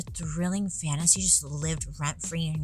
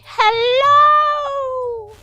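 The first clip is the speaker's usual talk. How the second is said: shrill falsetto granny shout, much higher and louder; Mrs Doubtfire; 13 dB louder than usual. Retold or imitated. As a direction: belt out a cheery old-lady hello in a high falsetto. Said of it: "Think, like, Miss Doubtfire".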